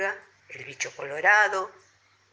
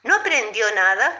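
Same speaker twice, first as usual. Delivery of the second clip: hint of a toad, high and loud; lightly voiced toad; 9 semitones higher, 3 dB louder than usual. A narrator's reading. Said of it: "Entonces el sapo le dijo".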